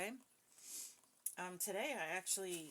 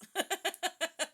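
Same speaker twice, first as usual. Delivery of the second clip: loud, high burst of real laughter; 12 semitones higher, 12 dB louder than usual. Real, not acted. Reader hears the box say "with toilets" and bursts out laughing. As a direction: crack up at the absurd message.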